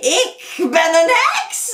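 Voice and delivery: slow, high, loud witch voice; witch reveal. Acted. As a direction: announce it with menace.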